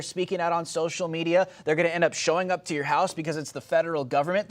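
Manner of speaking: normal talking voice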